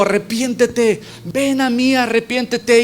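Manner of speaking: high-pitched appeal to repent; acted urgent call. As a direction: call them to you, urgently. Voicing Jesus calling to repent.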